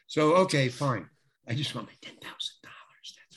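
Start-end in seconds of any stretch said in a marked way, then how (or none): 1.5-3.4 hushed, whispered aside of weary resignation; whispered grumble. Retold or imitated. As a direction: whisper it low, as if to yourself. Grumbling to himself about the job.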